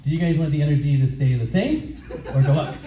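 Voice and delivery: ordinary delivery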